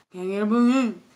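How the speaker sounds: hammed-up mush-mouthed customer; mumbling imitation. Customer repeating to confused cashier, exaggerated mock mumble. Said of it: "people mumble".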